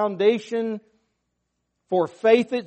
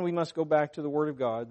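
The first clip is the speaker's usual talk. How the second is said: usual speaking voice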